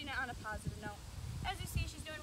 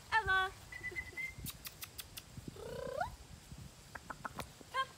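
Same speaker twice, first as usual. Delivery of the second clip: excited call; 10 semitones higher than usual. Shown as a demonstration. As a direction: call the dog in, high and eager. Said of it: "Using an excited tone of voice".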